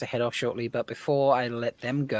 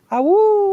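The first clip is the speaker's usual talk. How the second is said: long high wolf howl; 9 dB louder than usual. Acted. Welcoming Wolf Brother with howl.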